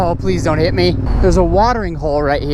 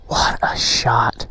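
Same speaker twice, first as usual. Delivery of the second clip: slow hushed whisper; awed whisper; 9 semitones lower, 4 dB quieter, much slower than usual. Real, not acted. Vlogger admiring the shot for viewers, slow whisper. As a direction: whisper it slowly in awe. Awed at the view while filming.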